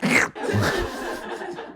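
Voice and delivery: breathy silent laugh at own joke; soft airy chuckle; real laugh. Real, not acted. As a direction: laugh quietly under the breath. Laughs at the soup slurper.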